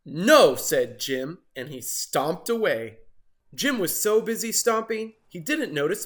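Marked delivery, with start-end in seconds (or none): 0.1-0.6 grumpy no. Acted. Jim refuses.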